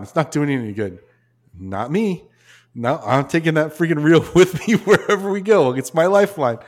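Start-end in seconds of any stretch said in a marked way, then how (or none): 1.6-6.6 higher, louder, laughing refusal; refusing to drop the reel, higher and louder with a laugh; defiant insistence. Retold or imitated. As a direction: dig in your heels about the reel.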